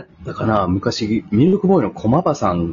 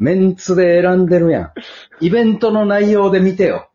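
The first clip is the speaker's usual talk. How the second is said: higher, louder hammed-up complaint; mock indignation; 10 semitones higher, 5 dB louder than usual. Protesting on the wife's behalf, hammed-up and higher. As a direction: play the outraged guest, half joking.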